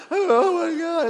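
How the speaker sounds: high, loud mock wail; mock weeping. Preacher acting out his own tears, mock weeping. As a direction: wail it as if crying a river. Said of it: "I'm crying like a river".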